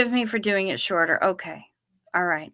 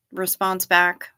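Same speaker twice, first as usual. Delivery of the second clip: ordinary talk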